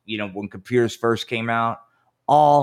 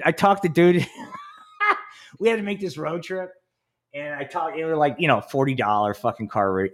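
normal talking voice

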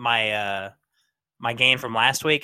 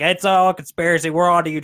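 mock accusing shout; 5 semitones higher, 6 dB louder than usual. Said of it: "and say, like".

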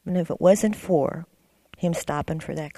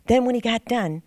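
ordinary delivery

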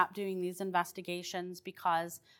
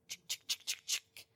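unvoiced clicking "chick" bullet sounds; breathy bullet clicks; 12 dB quieter than usual. A tour guide imitating the peas' bullet sounds, clicking breathily. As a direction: hiss out little clicks like peas striking. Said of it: "they made the sound of bullets".

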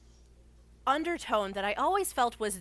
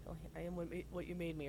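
very soft, lowered murmur; under the breath; 3 semitones lower, 12 dB quieter, much faster than usual. Retold or imitated. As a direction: murmur it to yourself, barely audible. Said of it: "under my breath".

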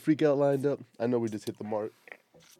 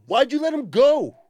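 much higher and louder, outraged; exasperated shout; 14 semitones higher, 11 dB louder than usual. Real, not acted. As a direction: yell at the screen in disbelief. Yelling at instructor in video.

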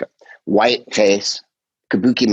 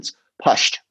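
Glaswegian accent. Shown as a demonstration. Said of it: "if they say in a Glaswegian accent".

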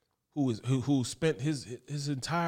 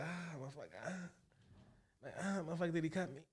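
mock pained, much softer mumble; dazed groan; 15 dB quieter, faster than usual. Fighter holding his head after the knockdown, softly groaning. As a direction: imitate a hurt fighter moaning.

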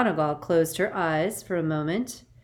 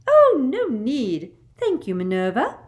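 simpering; 8 semitones higher, 9 dB louder than usual. A narrator's reading. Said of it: "simpered Professor Umbridge".

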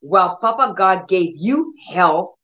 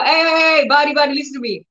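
commanding call to body; 7 semitones higher, faster than usual. Shown as a demonstration. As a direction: call out sharply to your own body. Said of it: "I will go".

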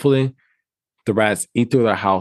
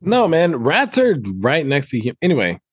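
ordinary delivery

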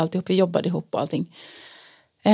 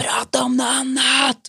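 mock cry, higher and slowed; retold scream; 5 semitones higher than usual. Friend grabbing her hand, demanding a new topic, mock yell. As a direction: imitate a frightened friend yelling, half-voiced. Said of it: "Bara skriker".

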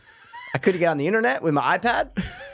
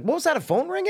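confused surprise; 12 semitones higher than usual. Retold or imitated. Acting his confused self.

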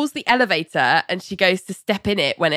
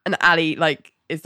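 ordinary delivery

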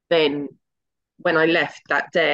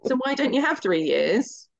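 higher, lightly voiced coaxing father; dad persuading; 6 semitones higher than usual. Father coaxing his daughter to go to uni, lightly voiced and higher. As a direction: gently talk her into uni.

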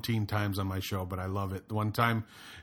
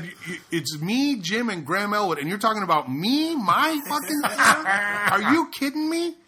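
mounting mock outrage; 10 semitones higher, 4 dB louder than usual. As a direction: work yourself up until you are shrieking in disbelief. Retold reply to heckler, escalating.

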